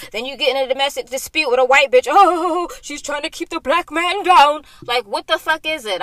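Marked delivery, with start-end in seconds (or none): 2.1-4.6 high, loud mocking voice of the man; mock him with a high, whiny complaint; mocking whine. Mocking the man's excuse.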